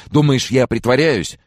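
high, loud indignant question; outraged, voice jumping up. A narrator's reading. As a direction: fling it back, offended and loud. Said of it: "обдал меня возмущённым взглядом".

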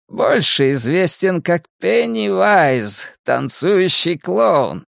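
slow, high, stretched crone voice; creepy old Mrs. Kersh. A narrator's reading. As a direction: savour each name slowly, an uncanny old witch. (зловещая старуха, медленно и высоко)

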